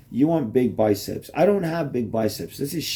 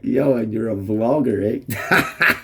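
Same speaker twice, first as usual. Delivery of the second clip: imitating laughing kid; slower than usual. Kid Ghazi quoted, laughing.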